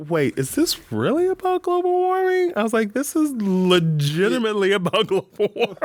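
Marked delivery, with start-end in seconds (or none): 0.1-2.5 high-pitched mock disbelief; incredulous. Retold disbelief watching a film. 2.9-5.8 amused, high-pitched mock astonishment; incredulous. Retold disbelief watching a film.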